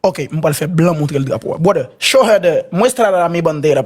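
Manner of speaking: ordinary talk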